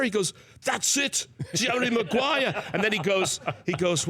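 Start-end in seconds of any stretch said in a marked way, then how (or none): 0.6-2.6 excited confirmation. Retold or imitated. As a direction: savor the name with delight.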